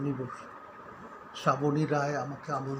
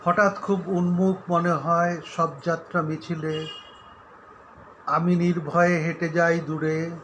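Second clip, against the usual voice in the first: louder, measured declamation with pauses; solemn, projected poetry reading; poem recitation; 6 dB louder than usual. Acted. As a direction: recite the poem firmly, phrase by phrase. Recites own Bengali poem.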